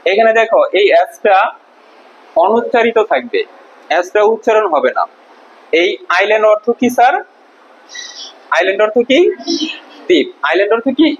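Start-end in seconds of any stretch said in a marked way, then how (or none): none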